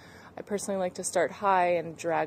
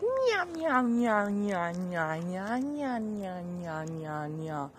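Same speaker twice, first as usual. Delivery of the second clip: playful meowing; much slower than usual. Shown as a demonstration. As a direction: meow like a cat, descending. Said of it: "get a little wacky and play around".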